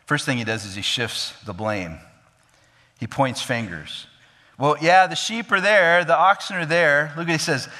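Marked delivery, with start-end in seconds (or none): 4.6-7.1 defensive excuse-making, raised voice; Saul making excuses. Acted. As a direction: push the blame off, a bit higher. Saul shifting blame, acted quote.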